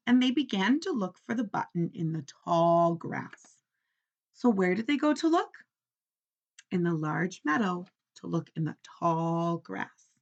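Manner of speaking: ordinary delivery, nothing marked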